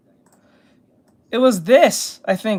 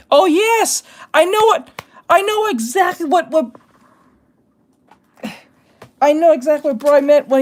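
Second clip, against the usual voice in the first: excited realisation; 9 semitones higher, 3 dB louder than usual. Real, not acted. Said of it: "sudden realisation".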